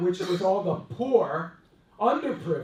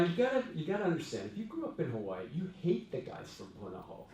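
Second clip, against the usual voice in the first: usual speaking voice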